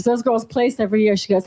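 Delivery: ordinary talk, nothing marked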